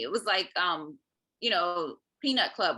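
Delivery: ordinary delivery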